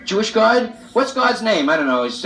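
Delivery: normal talking voice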